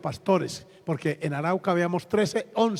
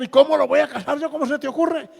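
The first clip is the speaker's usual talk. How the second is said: loud, high-pitched disbelief; re-enacted indignant astonishment; incredulous protest; 9 semitones higher, 6 dB louder than usual. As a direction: object as if the request were absurd. Protests at being asked to officiate.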